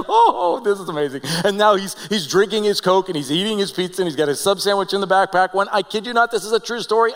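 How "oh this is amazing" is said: high, loud mock "oh" of amazement; amazed reaction. Joel is handed free Coke, amazed reaction. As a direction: exclaim it like a delighted student. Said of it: "Joel's just like".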